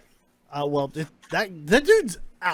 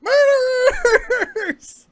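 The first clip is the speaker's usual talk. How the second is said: freaked-out yelling; 8 dB louder than usual. Acted. Captive accusing the party over the body, panicked yelling. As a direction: yell it high and stretch the word out.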